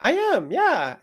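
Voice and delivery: high, delighted agreement; joyful. Retold or imitated. Wife confirming her joy to her husband, brightly excited. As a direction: imitate a wife lighting up. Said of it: "you seem really, like, joyful".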